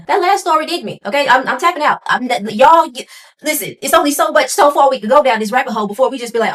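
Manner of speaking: loud, high, flustered protest; overwhelmed excited. Real, not acted. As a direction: throw up your hands, loud. Overwhelmed reaction, tapping out.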